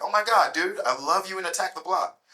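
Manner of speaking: high, loud excited Tom Cruise gush; high excited American fan voice; Tom Cruise impression. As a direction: gush like a starstruck American star. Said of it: "I hear this very familiar voice".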